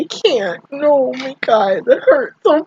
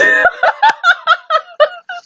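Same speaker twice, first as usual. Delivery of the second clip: squeaky breathless laugh; high giggle. Real, not acted. Fan giggling at her own hormones. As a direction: laugh at yourself mid-tears.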